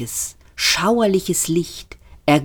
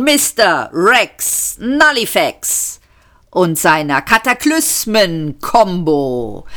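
höher, schwungvoller Conférencier; kündige den Weltuntergang an wie eine Gala; showmaster Max; 6 semitones higher than usual. A narrator's reading. Max kündigt die Band an. (Max begrüßt das Publikum als gut gelaunter Showmaster)

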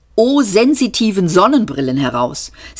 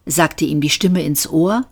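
plain reading voice

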